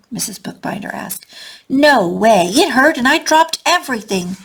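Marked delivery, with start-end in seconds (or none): 1.7-4.4 louder, higher indignant snap; upset child snapping at his teacher. A narrator's reading. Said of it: "Schubert snaps".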